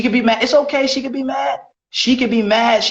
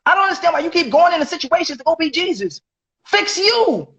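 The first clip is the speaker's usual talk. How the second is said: exasperated; 9 semitones higher than usual. Real, not acted. Exasperated scolding of friend.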